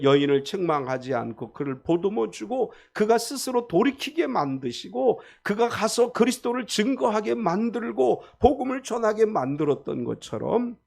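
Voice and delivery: ordinary delivery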